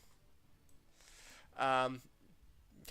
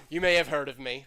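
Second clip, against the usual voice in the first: grand scientist voice; 13 dB louder than usual. Acted. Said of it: "then he's like".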